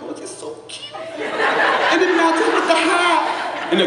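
very high, gushing coo; a proud grandpa at Walmart, cooing very high; gushing over cute things. Retold or imitated. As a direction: coo like someone melting over a baby item. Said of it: "I'm like".

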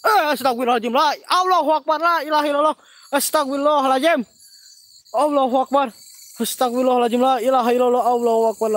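loud, high startled invocations; frightened praying. Real, not acted. Host sees something and prays, loud and alarmed. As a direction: cry out prayers in fright, easing slightly in pitch. Sudden fright, crying out prayers.

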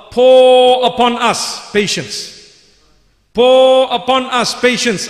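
"pour" stretched long and slow; fervent plea. A narrator's reading. Translating a plea from the verse.

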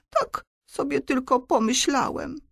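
put-on high, meek boy's voice; timid excuse. A narrator's reading. Antek explains himself to the vampire, timid. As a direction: excuse yourself shyly. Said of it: "powiedział Antek nieśmiało".

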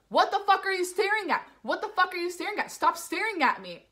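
high, loud confrontational demands; imitated angry accusation. Man accusing passenger of staring, imitated angry outburst.

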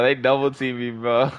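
usual speaking voice

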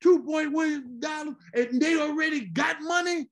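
dad ranting about the church's money, high-pitched; indignant dad. Retold or imitated. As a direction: rail against the donation. Father voiced in the story.

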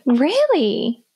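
incredulous follow-up question; taken aback, swooping question; surprised disbelief. Real, not acted. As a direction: question it in real astonishment. Still disbelieving the nurse answer.

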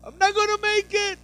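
very high, loud, frantic worrying; panicked worrier. Retold or imitated. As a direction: fret in a high panicked squeal. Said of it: "and you're like".

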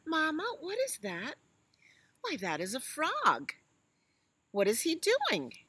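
high, eager little elephant; high child voice. Acted. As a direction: ask like a curious little calf. Little elephant asks mama.

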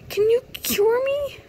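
high, loud, begging the doctor; pleading high voice. Pretend. Player voicing her sim begging Doctor Campbell, high and pleading. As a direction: plead like a desperate patient.

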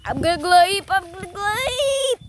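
excited. Real, not acted. Hyping up a jump.

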